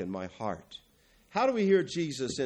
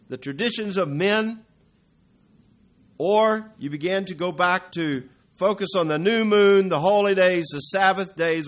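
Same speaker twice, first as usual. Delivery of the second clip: louder, higher, rising preacher's cry; full-force preaching; 5 semitones higher, 6 dB louder than usual. Acted. Preacher warning the congregation against old laws, at full force. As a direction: pound it home from the pulpit.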